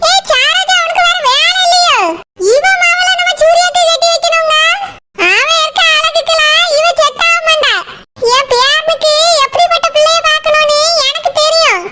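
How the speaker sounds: shrill grandmother putting her daughter-in-law in her place; old mother-in-law. Acted. Mother-in-law scolding Saroja about a bride for Surya, high scolding old woman voice.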